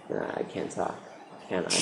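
whispered aside to himself; hushed self-correction while playing. Real, not acted. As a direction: breathe it with no voice. Unvoiced aside.

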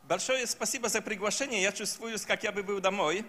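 Polish-accented halting Russian; broken Russian with a Polish accent; foreign accent Russian. Real, not acted. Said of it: "Извините за мой р-, русский язык".

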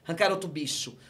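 ordinary talk

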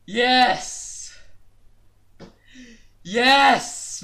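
loud, high cheering; excited shout. Real, not acted. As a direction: yell it out in triumph. Ranks up in game.

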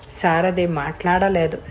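plain reading voice

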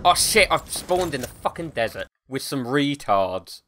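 ordinary talk

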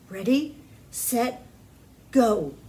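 drawn out, then a loud go; slow build to go. A narrator's reading. Jack psyching himself up to charge, building. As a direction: steel yourself and launch.

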